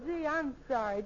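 usual speaking voice

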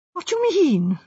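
put-on anxious young man taken aback; alarmed. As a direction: demand it uneasily. Architect alarmed by inspector's remark.

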